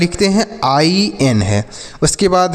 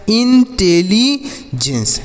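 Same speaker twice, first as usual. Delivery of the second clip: Intelligence stretched slowly and a bit higher; slow model reading; 4 semitones higher, much slower than usual. Shown as a demonstration. Teacher to pupils, showing how to read it, slow. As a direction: spell the sounds of intelligence out slowly. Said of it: "ऐसे पढ़ेंगे intelligence".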